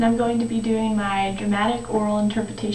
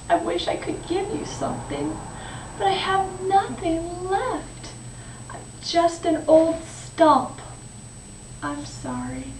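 regretful; 4 semitones higher than usual. Acted. The tree is just an old stump, regretful. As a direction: draw out the regret and sink to a hushed apology.

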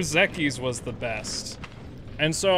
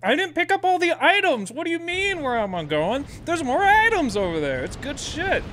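worked-up protest; 11 semitones higher than usual. Real, not acted. Streamer answering chat about leaving items behind, high-pitched protest. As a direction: argue back at a much higher pitch.

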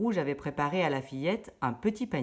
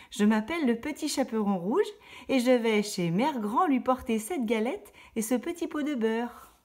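put-on higher little girl voice, innocent and eager; 7 semitones higher than usual. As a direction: answer like a trusting little girl. La fillette répond au loup. (petite fille naïve à la voix haute)

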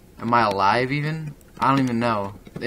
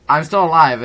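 shouted, higher and much louder; excited shout; 6 semitones higher, 11 dB louder than usual. Real, not acted. Gamer shouting at his mangled ragdoll, excited shout. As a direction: yell it in amazed triumph.

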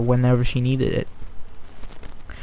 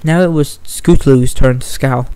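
plain reading voice

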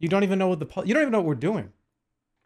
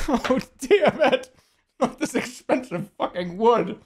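slow, higher hammed-up fuss; mock posh outrage; 8 semitones higher, 4 dB louder, slower than usual. Streamer mocking fuss over a drink on the table. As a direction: play a fussy snob over the wood.

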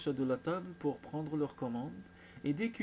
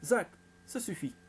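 mildly raised rebuke; cried out; 4 semitones higher, 4 dB louder than usual. A narrator's reading. Father checking his son while hiding amusement, crying out mildly. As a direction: cut him off with a higher voice. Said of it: "s'écria Jacques".

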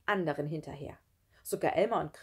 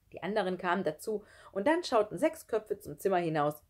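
ordinary delivery, nothing marked

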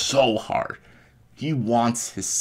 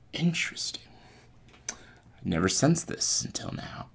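hushed, intrigued villain whisper; menacing whisper; 6 semitones higher, 8 dB quieter than usual. Retold or imitated. Villain sensing the Force in a fallen foe, soft whisper. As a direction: whisper it as if musing to yourself.

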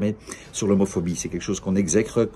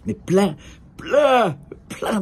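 much higher, hammed-up emphasis; insistance exagérée; 11 semitones higher, much slower than usual. Real, not acted. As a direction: exaggerate it, pitch climbing on each repeat. Exagère le nombre de drapeaux. (insistance exagérée, voix qui monte très haut)

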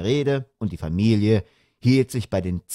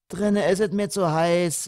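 put-on high-pitched Berlin-dialect girl; daughter's voice; 9 semitones higher than usual. A narrator's reading. Daughter dodging the unpacking, high childish complaint.

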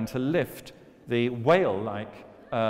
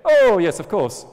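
eager loud reply; 8 semitones higher, 13 dB louder than usual. Acted. Manu answers eagerly.

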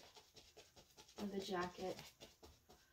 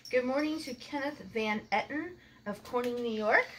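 cheerful shout-out; 2 semitones higher, 7 dB louder than usual. Acted. Shout-out greetings in the car.

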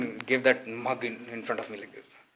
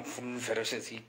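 ordinary delivery

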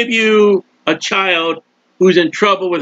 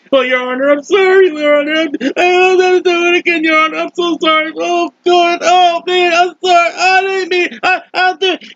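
high, whimpering begging to the judge; whiny defendant; 9 semitones higher than usual. Acted. Defendant begging the judge, high whiny pleading. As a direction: grovel to the judge, pitch way up. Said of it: "that person there in the courtroom".

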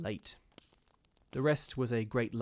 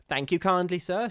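put-on higher, louder scornful old charwoman; the charwoman scoffing at ghosts, higher and louder; superior charwoman; 8 semitones higher, 7 dB louder than usual. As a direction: sniff down at the gentleman's fears. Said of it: "she answered".

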